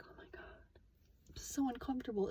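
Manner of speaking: whispered inner cringe; horrified whisper. Retold or imitated. Reacting inwardly to his comment, mortified whisper.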